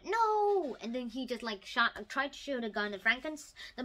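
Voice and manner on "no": a short high cry of no; retold shout. The hero shouts as his bride lies dead, loud cry. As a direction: call out no, half-acted. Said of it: "so he shouted".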